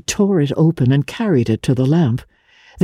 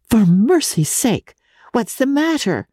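alarmed; 8 semitones higher than usual. A narrator's reading. Janey alarmed at his laughter.

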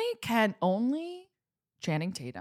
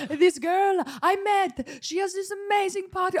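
high guy voice; 11 semitones higher than usual. Pretend. Imitating Kyle voice.

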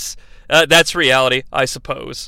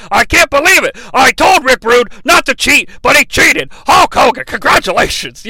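hammed-up fawning manager, much higher and louder; Heenan sucking up; 7 semitones higher, 5 dB louder than usual. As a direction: switch sides and flatter Hogan in a put-on whine. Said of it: "be like".

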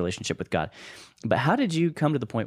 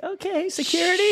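much higher, mock-alarmed call for security; alarmed woman calling security; 4 dB louder than usual. To a stranger she finds insane, mock-alarmed high call. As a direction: squeal it like a scandalized lady. Friend mimics shocked woman.

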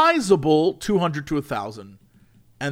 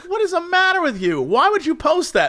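high, exasperated telling-off; high-pitched acted outrage; mock scolding; 12 semitones higher than usual. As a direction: demand an explanation in hammed-up dismay. Acting out scolding of poster.

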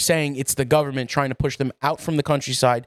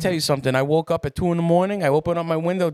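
usual speaking voice

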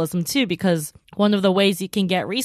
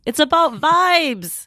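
slow, higher, savoring vibes; dreamy insistence; 8 semitones higher, slower than usual. Real, not acted. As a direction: stretch it out dreamily. Repeats slower, higher.

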